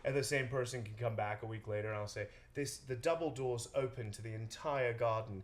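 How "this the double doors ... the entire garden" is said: slow posh London estate agent voice; slow, put-on British accent showing off a garden. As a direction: drawl it like a London realtor selling a house. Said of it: "incredible how far the accent goes".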